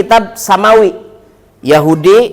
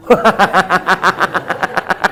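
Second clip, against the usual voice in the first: long rapid chuckle; laughter; 6 dB quieter than usual. Real, not acted. Teasing the audience over missing proof, laughing.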